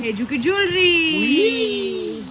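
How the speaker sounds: high, slow, stretched-out sing-song; playful sing-song. Pretend. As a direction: stretch it out high for a little one. Sing-song talk to child.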